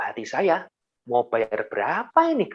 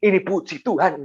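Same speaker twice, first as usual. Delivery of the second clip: inner excitement; 4 semitones higher than usual. Retold or imitated. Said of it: "tapi hatinya".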